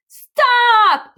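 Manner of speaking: very high, loud shout. A narrator's reading. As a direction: yell it to halt her. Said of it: "shouted Duff".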